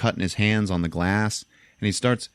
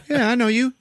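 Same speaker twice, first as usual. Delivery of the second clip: higher, menacing recognition; 4 dB louder than usual. Retold or imitated. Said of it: "and he's like".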